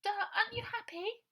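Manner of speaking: put-on high, loud happy child voice; a small girl bubbling about Christmas; excited little girl. A narrator's reading. Bonnie telling Ida about the toys, high excited child.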